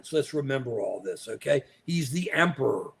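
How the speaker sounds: normal talking voice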